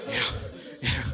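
ordinary delivery

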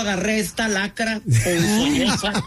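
high-pitched comic lament; mock exasperation. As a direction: bemoan your bad choice, hammed up for laughs. Mock lament about choosing this partner. (lamento exasperado y cómico, agudo)

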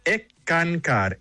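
"ecancar" in three syllables, e-kan-KAR, stress on the last; correct pronunciation. Shown as a demonstration. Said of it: "se pronuncia". ("ecancar" en tres sílabas, e-kan-KAR, acento al final)

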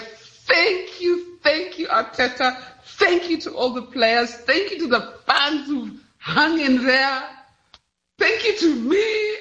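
elated, lilting roll-call of thanks; jubilant thanks. Real, not acted. Arsenal fan thanking team, fans and herself, jubilant. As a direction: celebrate each thank-you, building to a high finish.

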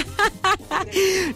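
high short giggle. Real, not acted. Host laughing at compa Virto's smile, high giggle. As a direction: laugh lightly.

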